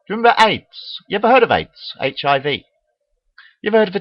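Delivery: high angry rant. Real, not acted. Ranting at doom believers.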